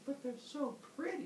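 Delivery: high, soft mock whine; naive, pouty protest; pleading. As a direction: plead wistfully in a small voice. Humans plead for the sticks.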